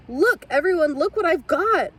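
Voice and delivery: put-on an excited girl who can't hold it in; a would-be whisper that comes out loud and eager; fierce stage whisper gone loud. A narrator's reading. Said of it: "Lily whispered fiercely".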